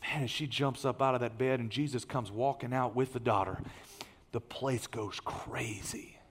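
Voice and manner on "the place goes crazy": whispered and slow; awed whisper. Real, not acted. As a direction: breathe it out in awe. Climax of the miracle story.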